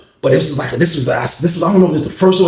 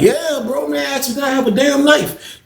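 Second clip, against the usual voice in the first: excited exclaim; 11 semitones higher than usual. Retold or imitated. Emphatic confirmation to roommate.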